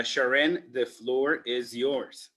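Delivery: normal talking voice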